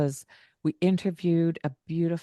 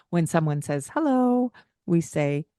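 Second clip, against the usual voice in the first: ordinary talk, nothing marked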